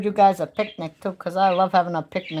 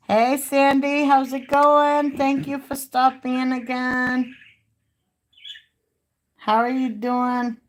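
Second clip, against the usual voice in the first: higher, drawn-out welcome; cheery greeting; 5 semitones higher, 3 dB louder, slower than usual. Acted. Greeting a viewer who stopped by, higher and drawn-out. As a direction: sing out a friendly hello, slowly.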